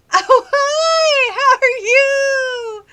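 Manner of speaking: very high, loud, drawn-out sing-song hello; mock gushing greeting. Woman greeting a scam caller after hearing five million, mock-gushing squeal. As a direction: squeal a greeting as if to a long-lost friend.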